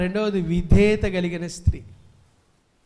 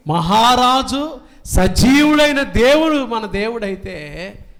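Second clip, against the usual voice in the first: raised, ringing pulpit voice; fiery preaching; 4 semitones higher, 6 dB louder than usual. Acted. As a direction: preach it out to the whole church. Exalting God's names. (ఉత్సాహంగా బోధించే స్వరం)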